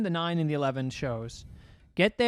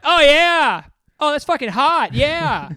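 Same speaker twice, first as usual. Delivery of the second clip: much higher, louder rowdy hooting; rowdy teens; 11 semitones higher, 11 dB louder, slower than usual. Retold or imitated. Teens heckling during a set, loud high rowdy hooting. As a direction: hoot like drunk teens in the crowd. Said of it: "They're just like".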